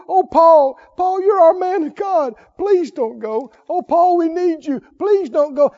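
mock tearful pleading. Preacher voicing Paul's friends begging him to stay, mock tearful pleading. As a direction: plead with him, lightly acting the tears. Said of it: "they're crying and pulling".

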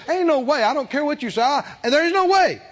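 high, insistent mock defiance; acted stubborn refusal; defiant. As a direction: talk back as the defiant people. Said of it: "they had repeatedly said defiantly to him".

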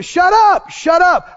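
high, forceful command to silence doubt; speaking out loud. Acted. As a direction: cut the nagging thought off firmly. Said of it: "open your mouth and say".

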